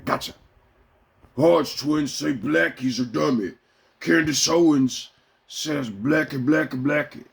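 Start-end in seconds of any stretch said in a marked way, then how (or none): 1.3-7.2 slow, sneering mock repetition; mocking pundits. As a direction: drag it out to ridicule them.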